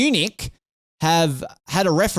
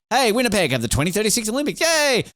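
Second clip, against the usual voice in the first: mock cheerful announcement; 9 semitones higher than usual.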